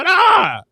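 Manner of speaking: sudden high, loud yell; excited yelp. Real, not acted. Host itching to jump into the comments, high shout. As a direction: let out an eager shout.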